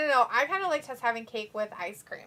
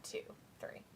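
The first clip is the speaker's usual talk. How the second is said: hushed counting; whispered count; 19 dB quieter than usual. Real, not acted. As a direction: whisper the count under your breath. Counting the pin quietly.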